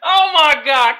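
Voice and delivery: normal talking voice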